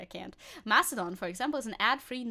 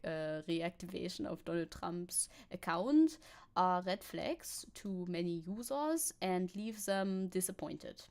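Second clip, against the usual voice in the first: put-on slow, deliberate German accent; put-on German accent, reading out a quote; 2 semitones lower, 3 dB quieter, slower than usual. Streamer reading a German expert's quote aloud, bad German accent. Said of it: "I'm bad at my German accent".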